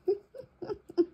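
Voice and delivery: high breathy laugh near tears; helpless laugh. Real, not acted. Crafter laughing at her ruined fern, high and helpless. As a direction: laugh in disbelief.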